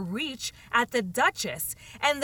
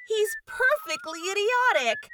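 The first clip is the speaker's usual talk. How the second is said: desperate; 11 semitones higher, 5 dB louder than usual. A narrator's reading. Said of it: "said Alice desperately".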